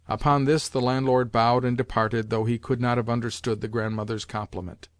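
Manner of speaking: ordinary delivery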